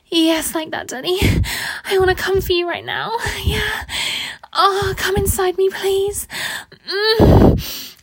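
high, loud, pleading moans; urgent passion. Acted. Woman at the peak of passion with her lover, pleading. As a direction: plead urgently, breaking into cries. Acted love scene nearing its climax.

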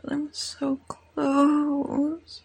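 drawn-out, aroused moan; slow, straining moan; moaned slowly. Acted. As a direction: stretch the words into a moan. Says they are near climax.

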